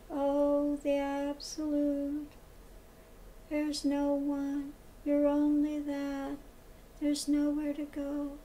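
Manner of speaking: mock the evenly spaced, level guru delivery; very metered voice. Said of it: "will talk in a very metered voice".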